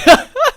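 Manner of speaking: high loud laugh; burst of laughter. Real, not acted. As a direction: crack up at the memory. Laughing at firework mishap.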